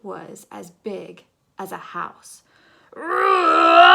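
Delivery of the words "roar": huge shouted roar, much higher; monster roar. Acted. Yellow as a house-sized monster roaring at Swatch. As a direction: roar like a giant beast. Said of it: "went yellowest yellow".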